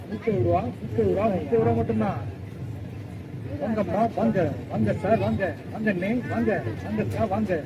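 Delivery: singsong street hawker. Acted. Hawker calling out prices.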